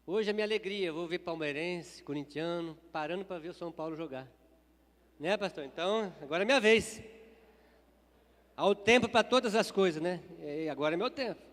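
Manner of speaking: rising joyful boast to the church. Real, not acted. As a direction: proudly announce it's your turn now. Excited boast before football clip. (empolgado e em voz alta)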